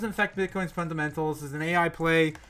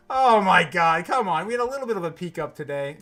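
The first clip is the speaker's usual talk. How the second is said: usual speaking voice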